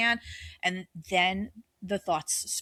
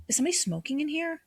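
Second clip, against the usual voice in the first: mock alarm; 6 semitones higher, faster than usual. Retelling smelling phantom smoke.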